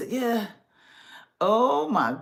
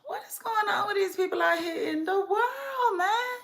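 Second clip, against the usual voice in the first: high, wailing mock cry; hammed-up tearful wail at the news; 10 semitones higher than usual. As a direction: whine it like you're about to cry. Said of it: "This news got me like".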